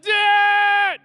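long, high, blaring shout imitating a small boy; son's long yell. Three-year-old son calling his father, imitated drawn-out yell. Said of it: "we yell it really loud".

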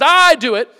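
"I" stretched high and loud, "do it" tumbling after; stress on "I". Shown as a demonstration. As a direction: lean hard on "I" like an insistent toddler. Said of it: "The I is really emphasized".

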